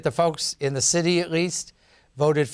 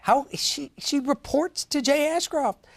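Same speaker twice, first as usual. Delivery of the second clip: heated; 9 semitones higher than usual. Real, not acted. Heated on-air argument, rising.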